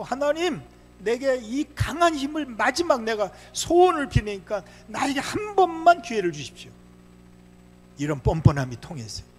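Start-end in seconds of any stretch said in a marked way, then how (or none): 0.0-6.7 high-pitched, forceful pleading prayer; brazen prayer. Acted. Samson begs God for one last chance, brazen pleading. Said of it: "뻔뻔하게 하나님 앞에 그냥 기도하고 있어요".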